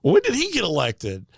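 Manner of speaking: a hint of a bewildered grandma; puzzled old lady. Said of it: "And she goes".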